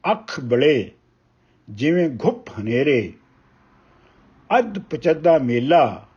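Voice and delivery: measured, weighty reading of a Punjabi poem; ਕਵਿਤਾ ਪਾਠ ਦੀ ਠਹਿਰਾਵ ਵਾਲੀ ਅਵਾਜ਼; poem recitation. Acted. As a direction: recite each line slowly, letting pauses breathe. Poet recites poem Lukan Miti.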